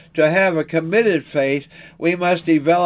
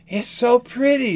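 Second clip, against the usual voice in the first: put-on little girl's higher-pitched exclaiming, read aloud; delighted exclamation; 7 semitones higher than usual. A narrator's reading. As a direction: exclaim it high, as an admiring child. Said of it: "she exclaimed".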